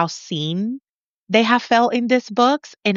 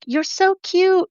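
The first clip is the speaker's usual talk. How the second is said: gushing fan; 11 semitones higher, slower than usual. Retold or imitated. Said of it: "people are like".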